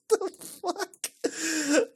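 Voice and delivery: high, drawn-out words through laughter; slow, high, laughing disbelief; helpless laughter. Real, not acted. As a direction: wheeze it out while cracking up. Cracking up at Kirby picture.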